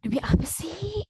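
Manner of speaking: slow, high breathy amazement; hushed incredulous question, rising; disbelief. Acted. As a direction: draw it out as if stunned. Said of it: "Gak nyangka gitu ya".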